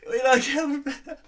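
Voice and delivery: high, slow, laugh-choked words; can barely speak for laughing; laughing helplessly. Real, not acted. Laughing fit.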